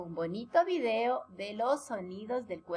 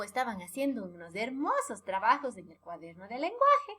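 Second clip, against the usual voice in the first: usual speaking voice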